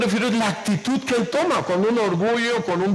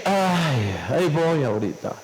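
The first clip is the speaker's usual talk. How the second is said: sleepy reluctance; 6 semitones lower than usual. Retold or imitated. Preacher reenacts answering God when woken at night, sleepy and reluctant. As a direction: imitate being dragged out of warm sleep. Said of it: "como que, ah, despertarme, cuando estás bien tranquilo".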